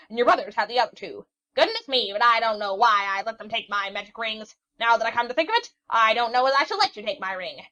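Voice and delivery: put-on an old witch scolding the young sailor; cranky old witch. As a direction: rattle on like a fussy old hag. Witch gives the ring.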